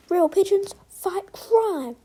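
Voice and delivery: slow, proud, loud slogan. A narrator's reading. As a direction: declare it like a motto. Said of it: "what Rock said before".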